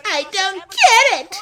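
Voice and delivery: very high, loud cartoon voice; OVA Sonic. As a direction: squawk it out high, stretching the words. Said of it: "more O V A Sonic voice".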